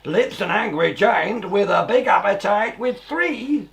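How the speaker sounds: put-on lively rhyming read-aloud for children; a storyteller sharing a rhyme with small children; storybook reading. A narrator's reading. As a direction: read the verse like a bedtime story. Reads rhyming verse of book.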